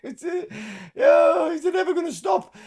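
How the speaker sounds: very high, loud disbelieving yell; exasperated outburst. Real, not acted. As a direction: cry out in mock despair, high.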